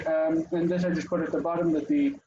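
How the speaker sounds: normal talking voice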